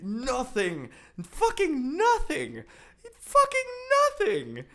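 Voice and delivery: loud, high, exasperated yelling; furious rant at the poster; angry shout. Real, not acted. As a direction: bellow it out in frustration. Angry rant about relevance.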